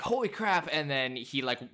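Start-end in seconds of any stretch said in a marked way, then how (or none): 0.0-0.7 higher, startled exclamation; a touch of stunned friend; mock amazed. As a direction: gasp it in shock. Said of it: "Curtis looks at him and is like".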